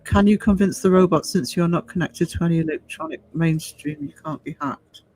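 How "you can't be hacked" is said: ordinary delivery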